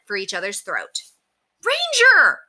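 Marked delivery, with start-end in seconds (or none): 1.6-2.4 very high, loud shriek of a name. A narrator's reading. Woman sees her dog start fighting, shrieking. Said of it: "shrieked the woman".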